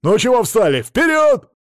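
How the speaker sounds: loud, very high rallying yell; shouted order climbing to a high cry; impatient shout. A narrator's reading. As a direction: yell at stragglers to get moving. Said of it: "обернулся и закричал".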